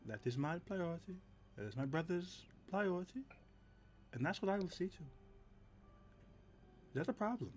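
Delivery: firm protective bodyguard, understated; Callow speaking plainly. Acted. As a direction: speak plainly, quiet resolve.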